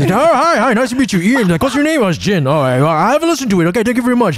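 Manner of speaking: high showy celebrity host. Retold or imitated. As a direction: play the polite TV star brushing off a fan. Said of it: "celebrity voice".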